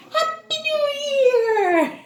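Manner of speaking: high, bright cheer for the class; cheerful greeting. Real, not acted. Teacher greeting young pupils, excited cheer. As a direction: sing out the holiday wish.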